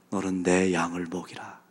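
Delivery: tender, quiet charge; the Lord gently sending Peter out; gentle command. Acted. The Lord's gentle commission.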